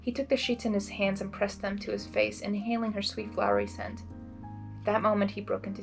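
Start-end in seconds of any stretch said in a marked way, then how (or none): none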